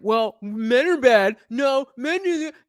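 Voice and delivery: lightly voiced bickering quote, slow; mock arguing voices. Streamer mimicking a back-and-forth argument, mild mock bickering. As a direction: toss the lines back and forth like a silly spat. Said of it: "it elevates it to just like".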